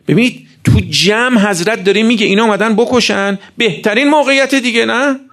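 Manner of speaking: high-pitched, urgent preacher's argument; impassioned preaching. Acted. Preacher telling listeners nobody there could accuse Imam Hossein, urgent preaching.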